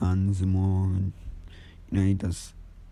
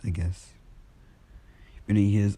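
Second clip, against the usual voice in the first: usual speaking voice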